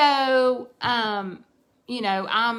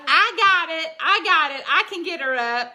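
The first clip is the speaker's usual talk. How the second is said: higher, louder eager insistence; eager offer; 6 semitones higher, 4 dB louder than usual. Retold or imitated. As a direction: jump in to help, full of energy. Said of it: "Brianna's like".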